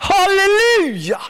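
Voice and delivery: high, slow, laughing praise cry; drawn-out joyful hallelujah; ecstatic halleluja. Real, not acted. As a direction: let the halleluja ring out with a laugh.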